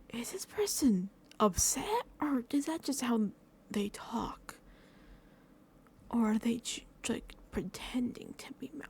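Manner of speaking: slow, unsure whisper to herself; puzzled inner whisper. Retold or imitated. Vlogger voicing her doubts about others' tone, in a whisper. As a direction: whisper it like you're second-guessing someone. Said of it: "Sometimes I'm like".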